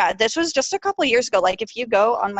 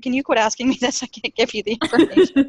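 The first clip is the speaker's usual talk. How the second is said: Siri; 4 semitones higher, 3 dB louder than usual. Retold or imitated. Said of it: "Siri's like".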